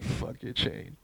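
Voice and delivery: unvoiced, under-the-breath jab; retold mutter. Telling a friend about kicking a bike, whispered. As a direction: whisper it like a private dig. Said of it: "I was like".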